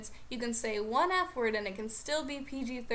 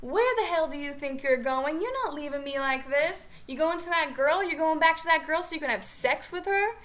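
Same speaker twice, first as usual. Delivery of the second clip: put-on louder, furious rant at the departing boyfriend; imitated yelling; 3 semitones higher, 5 dB louder than usual. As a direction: rant it like she's chasing him to the car. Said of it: "she's just yelling".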